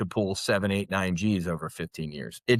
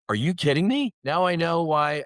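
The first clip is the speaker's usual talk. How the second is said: incredulous, higher-pitched retort; retold disbelief; 9 semitones higher, 3 dB louder than usual. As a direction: throw it out as an indignant question, pitched up. Acted reaction to appeal runaround.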